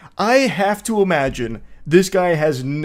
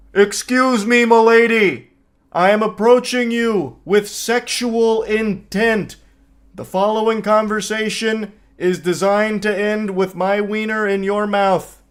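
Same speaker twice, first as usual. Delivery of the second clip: mock bellow; 6 semitones higher than usual. Screaming across the bar at a woman, mock bellow. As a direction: shout it like a pompous announcement across a room. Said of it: "just screaming from across the bar".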